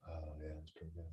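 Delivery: very low, hushed imitation of giving in; grudging approval. Host imitating an Italian guide won over by bacon, hushed and grudging. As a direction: drop it to a low, soft, half-laughing admission. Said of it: "He was like".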